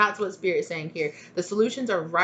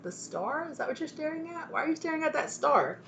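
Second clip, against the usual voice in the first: higher, softer coaxing pet voice; baby-talk to the cat; 9 semitones higher, 5 dB quieter than usual. Retold or imitated. Asking her cat why it stares at the star, pet talk. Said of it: "And I'm like".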